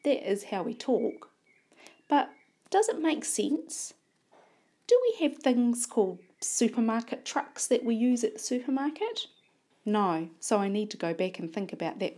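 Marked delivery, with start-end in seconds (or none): 2.1-9.3 questioning. Shown as a demonstration. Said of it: "teacher questions class".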